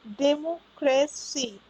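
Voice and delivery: डेमोक्रेसी slowly broken into separate chunks; slow, segmented डेमोक्रेसी; syllable by syllable. Shown as a demonstration. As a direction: sound out डेमोक्रेसी as डेमो, क्रे, सी. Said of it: "इसमें भी तीन syllable आ रहे हैं".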